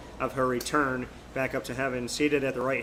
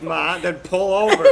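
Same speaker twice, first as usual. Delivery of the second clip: slow, loud, higher plea with a laugh in it; retold exasperated yell; 4 semitones higher, 7 dB louder than usual. Son telling laughing mom to pull over, retold exasperated yell. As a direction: draw it out, imitating a mock-desperate call.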